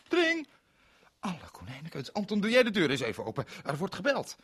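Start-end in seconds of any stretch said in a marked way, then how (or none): 0.1-0.5 very high loud ring; a shrill telephone tring; imitated phone ring. 2.2-4.4 loud, fast, high call; hurried shout across the house; raised voice calling Anton. Acted. Calling out to friend.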